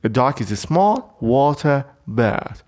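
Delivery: very slow, stress on small; slow dictation voice; slow definition read. Shown as a demonstration. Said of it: "ببینید توی جمله".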